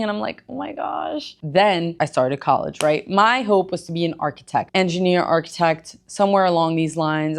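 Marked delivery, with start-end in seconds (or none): 0.5-1.4 high, soft gasped oh my gosh; breathy stunned reaction, much higher; surprised. Retold or imitated. Reacting to training requests.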